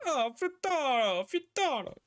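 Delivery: very high, slow hammed-up Italian accent; over-the-top fake Italian accent; bad Italian accent. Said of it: "really bad Italian accent".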